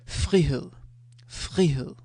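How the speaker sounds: "frihed" loud, with a throaty r; Danish word demo. Shown as a demonstration. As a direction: model "frihed" crisply. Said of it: "So, um".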